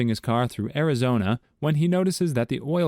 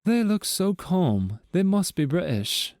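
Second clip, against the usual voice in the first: high, slow, prim English voice; English accent; 7 semitones higher, slower than usual. Acted. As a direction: put on a posh-sounding Englishman. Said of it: "The Englishman takes a look".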